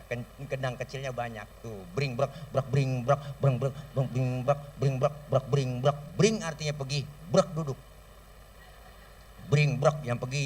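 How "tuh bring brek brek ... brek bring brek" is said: quick rhythmic drum syllables; imitating marawis drums. Shown as a demonstration. Preacher imitating children's marawis drums. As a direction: beat the little drums with your mouth. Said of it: "pakai marawis dia".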